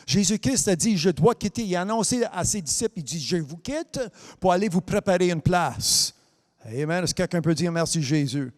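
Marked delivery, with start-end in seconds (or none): none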